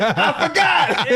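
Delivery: husband excuses himself, laughing. Retold or imitated. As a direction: drag it out through laughter. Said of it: "I'm like".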